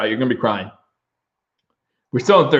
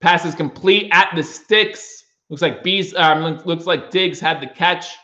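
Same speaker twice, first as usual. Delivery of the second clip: fast excited sportscaster call; play-by-play call; 6 semitones higher than usual. Acted. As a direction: rattle off the formation like a broadcaster. Fan streamer calls the game.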